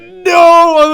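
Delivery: reliving the team's groan of horror; mock anguished yell, high and loud; bench despair. Bench reacts to airball.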